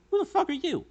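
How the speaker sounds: high startled shout; shocked yell. Real, not acted. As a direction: blurt it out in shock. Shocked by surprise witness.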